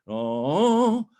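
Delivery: loud, high sustained "oh"; high held "oh". Shown as a demonstration. Coach shows the accent, high held "oh". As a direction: swell up on a long open vowel.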